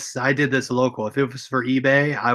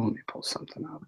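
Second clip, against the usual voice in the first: whispered aside; 15 dB quieter, faster than usual. Real, not acted. Muttering while searching screen.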